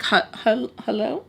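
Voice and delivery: halting, confused phone hello. Retold or imitated. Confused man on the phone, acted confusion. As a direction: stammer into a puzzled hello, mimicking him. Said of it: "even he's confused".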